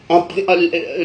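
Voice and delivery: usual speaking voice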